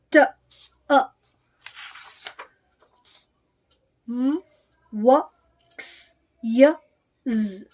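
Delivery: अ, ब, क as short letter sounds, a pause after each; phonic sounds. Shown as a demonstration. Teacher modelling how children should recite, अ, ब, क as short separate sounds. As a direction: recite अ, ब, क as isolated phonics, not letter names. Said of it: "वो इस तरह से बताएं".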